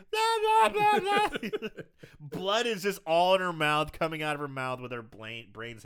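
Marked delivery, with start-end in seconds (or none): 0.1-1.7 high, loud mock babbling; mindless babble. Recapper imitating the brain-injured girl, loud silly babble. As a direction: blurt out silly high-pitched gibberish. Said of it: "And she's just like".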